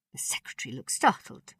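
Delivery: ordinary delivery, nothing marked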